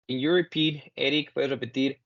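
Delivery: normal talking voice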